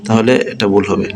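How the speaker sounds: usual speaking voice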